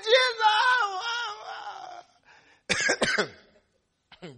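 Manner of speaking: high wailing cry to Jesus, dying away; acted screaming plea, trailing off. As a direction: wail out the name, then let it fade. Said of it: "She was screaming".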